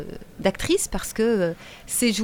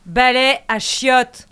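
authoritative; 3 semitones higher, 8 dB louder, slower than usual. Pretend.

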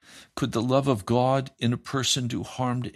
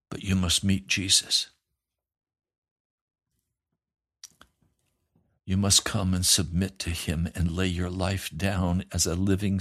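much lower, gentle counsel; tender pastoral reply; 4 semitones lower than usual. Retold or imitated. Pastor gently counselling the angry young man, low and tender. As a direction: soothe him like a caring pastor. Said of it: "I said".

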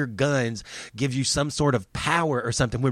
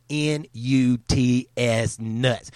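each letter N U T S spaced out, then nuts; spelled out slowly; much slower than usual. Real, not acted. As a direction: spell it out slow for emphasis. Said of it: "spelled out".

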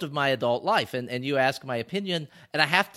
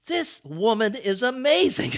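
amazed; 7 semitones higher than usual. Retold or imitated. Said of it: "and I'm like".

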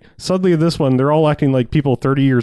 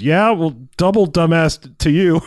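imitating Kirk; 5 semitones higher, 3 dB louder, slower than usual. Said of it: "His delivery of that is just really solid".

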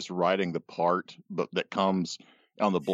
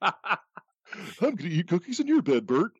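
high, quick puppet voice; cartoony Muppet teasing; Muppet impression; 10 semitones higher, faster than usual. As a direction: squeak it out like a Muppet. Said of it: "Sesame Street Muppets impersonating other Muppets".

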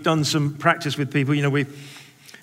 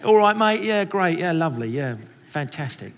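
mock movie Cockney patter; Cockney accent. Demonstrating how the country church talks, Cockney accent. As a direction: imitate a matey Londoner, falling away at the end. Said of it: "everybody talks like a Cockney".

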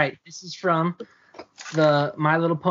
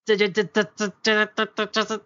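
stuttering broken connection; 4 semitones higher than usual. Real, not acted. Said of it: "now we can't hear you at all".

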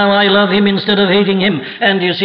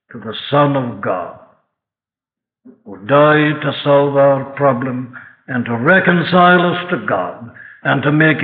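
closing prayer; 5 semitones lower than usual. Acted. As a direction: lay it down gently as a blessing.

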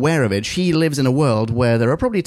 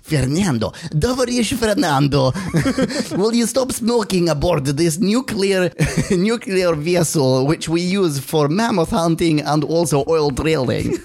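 higher comic Russian reprimand; Russian accent; 4 semitones higher than usual. Acted. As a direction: scold a comrade in mock Russian, breaking into laughs. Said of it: "this is my best Russian accent".

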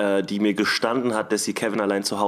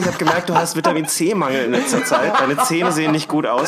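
higher, fussing pimp voice; caring pimp; 6 semitones higher than usual. Pretend. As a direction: play a doting pimp, half laughing. Role-plays caring pimp to Roxy.

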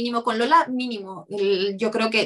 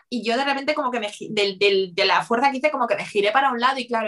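normal talking voice

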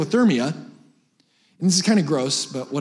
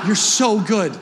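laughing mock praise; 3 semitones higher, slower than usual.

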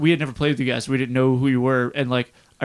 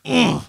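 short higher-pitched "ugh"; exasperated groan; 9 semitones higher than usual. Retold or imitated. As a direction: grunt of overwhelmed frustration. Mimed reaction to crowded stage.

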